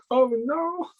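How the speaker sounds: high-pitched mock-dismayed "oh no"; comic little wail of dismay; retold dismay. Retold own dismay at online test.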